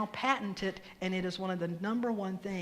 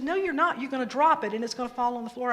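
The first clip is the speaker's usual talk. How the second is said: higher, louder retold mom arguing back; contradicting mom; 4 semitones higher, 6 dB louder, faster than usual. Mom warns kid she'll drop the egg, insistently contradicting. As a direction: imitate a parent firmly overruling a kid.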